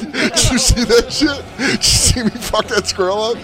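dog bragging to its owner after catching a squirrel, amped and laughing. Retold or imitated. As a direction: voice the dog hyped after the kill. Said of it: "she was so amped".

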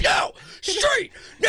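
very high, loud barked commands; imitated screaming. Friend imitating her ordering a scooter off, high mock scream. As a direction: screech each word as its own order, hammed up. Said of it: "You just scream back".

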